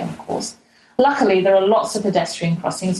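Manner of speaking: ordinary talk, nothing marked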